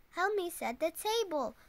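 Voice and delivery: usual speaking voice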